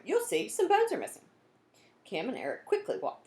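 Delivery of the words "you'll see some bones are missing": high and loud, sure of herself; eager insistence. Acted. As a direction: convince him with excitement. Cam insists to Eric.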